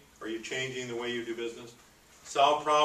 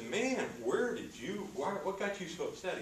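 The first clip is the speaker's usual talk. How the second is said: puzzled butcher; 6 semitones higher, 7 dB quieter than usual. Retold or imitated. Butcher asks angry manager.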